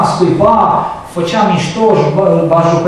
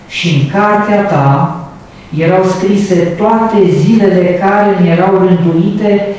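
slow, solemn scripture reading; drawn-out solemn reading from the pulpit; psalm reading; 4 semitones higher, much slower than usual. Acted. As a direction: read the psalm slowly, savouring each line.

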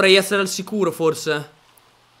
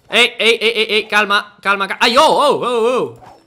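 loud alarmed shouts at the boars; panicked yelling; 4 semitones higher, 8 dB louder than usual. Real, not acted. Gamer panicking at charging boars, loud alarmed yells.

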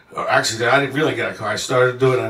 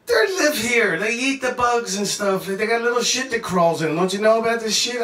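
higher-pitched incredulous insistence; retold exasperated argument; 7 semitones higher than usual. Traveler arguing with friend about bugs in food, exasperated. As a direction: argue it as if it were obvious.

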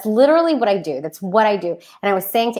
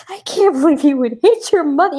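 hammed-up indignant mother, pitched up; mock outrage; 6 semitones higher than usual. Mom scolding her six-year-old, mock outraged. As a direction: play the wounded mom, half-joking. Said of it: "I was so mad that she had hit me".

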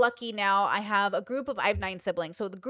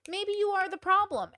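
higher, teasing agreement, leaning on problem; sassy higher retort; 10 semitones higher than usual. Real, not acted. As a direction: tease them, higher and sassy.

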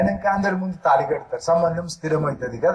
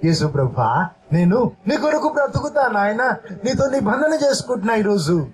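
earnest spoken prayer, pitched up; prayer said aloud; 4 semitones higher than usual. Shown as a demonstration. Preacher leads the congregation's vow to Jesus, earnest prayer. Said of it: "అని బయటికి చెప్పు పలికి".

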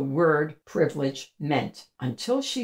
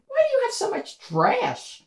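put-on higher girlish voice, curious; young girl voice. A narrator's reading. Sophie asking the trash-carrying girl, high girlish voice. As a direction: ask like a puzzled little girl.

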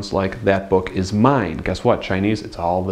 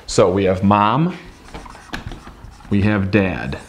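usual speaking voice

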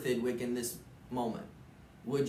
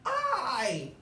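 shout; 11 semitones higher than usual. Acted. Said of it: "shouted Thidwick".

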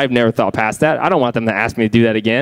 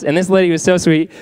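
normal talking voice

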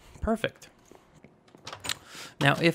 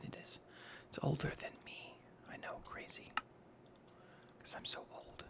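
whispered aside, voiceless and much softer; joking whisper; 20 dB quieter than usual. Acted. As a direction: drop to a secret whisper for the joke. Joking aside about old design.

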